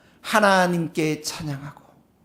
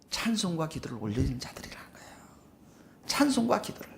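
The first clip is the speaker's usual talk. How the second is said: soft, fast whisper; 6 semitones lower, 15 dB quieter, faster than usual. Real, not acted. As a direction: lean in and whisper it. 설교 중 속삭임. (빠르게 속삭이며)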